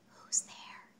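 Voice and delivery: hushed whisper at the door. A narrator's reading. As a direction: whisper it so no one wakes. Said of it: "I whispered".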